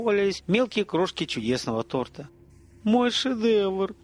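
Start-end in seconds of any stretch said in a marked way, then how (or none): 2.8-4.0 high, very slow, mournful; lightly acted heartbreak; bitter lament. A narrator's reading. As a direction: draw it out over a ruined creation. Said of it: "с горечью ответил повар".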